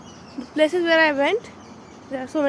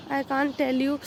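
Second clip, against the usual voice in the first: ordinary talk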